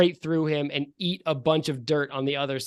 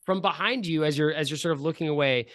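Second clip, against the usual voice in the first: usual speaking voice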